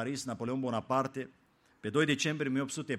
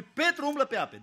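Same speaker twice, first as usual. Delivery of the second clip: „Petru umblă pe ape” proclaimed much higher; triumphant proclamation; 10 semitones higher, 4 dB louder than usual. Shown as a demonstration. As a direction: lift „Petru” high and proclaim it. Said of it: "Câtă vreme privește la Hristos". („Petru umblă pe ape” proclamat sus)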